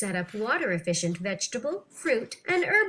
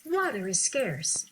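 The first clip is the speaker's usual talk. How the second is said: ordinary talk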